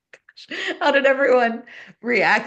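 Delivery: amused horror at the story; laughing disbelief. Retold or imitated. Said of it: "And I was like".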